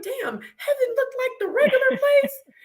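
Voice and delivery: high, laughing through the words; laughing and teary disbelief. Real, not acted. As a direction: crack up as you tell it. Laughing at her own confusion.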